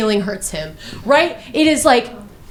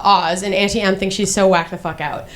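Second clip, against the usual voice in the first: normal talking voice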